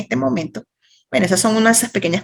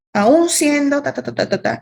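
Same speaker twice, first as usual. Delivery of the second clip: ordinary delivery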